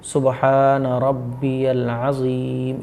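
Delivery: slow, even "सुबहान रब्बियल अज़ीम" with long vowels; correct recitation. Shown as a demonstration. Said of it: "इस तरीके से।". ("सुबहान रब्बियल अज़ीम" धीमे, सही उच्चारण)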